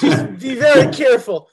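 loud, high New York tough-guy warning; New York accent. Pretend. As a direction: warn him in a thick New York accent. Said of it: "my New York accent".

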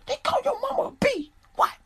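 high squeaky disbelieving dad; mock outrage. The dad hearing his mama was insulted, high and shocked.

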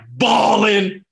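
loud, stretched-out exclamation; emphatic outburst. Retold or imitated. Player telling how he broke down, emphatic outburst. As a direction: belt it out long and high. Retells breaking down on draft call.

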